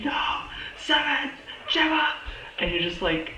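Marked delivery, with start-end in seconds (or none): 0.0-2.1 high, strained cry of a name; mock scream. Imitating Fitz screaming at Simmons, high mock yell. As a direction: yell after her as she jumps. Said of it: "Fitz is, like, screaming".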